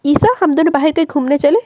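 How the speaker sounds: a smiling wife, voice lifted; wife playfully asks husband out; smiling invitation. A narrator's reading. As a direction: invite him out with a smile. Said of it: "मुस्कुराते हुए कहा".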